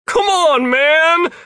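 loud, high-pitched plea; high, loud, exasperated yell; exasperated shout. A narrator's reading. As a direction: shout it down in frustration. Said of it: "Jeff shouted".

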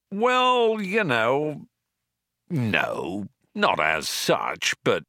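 awkward, drawn-out hedge starting high and dropping; stall, backing down word by word; hedging. A narrator's reading. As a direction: squirm out of it reluctantly. Zaphod hedging, cornered by Trillian.

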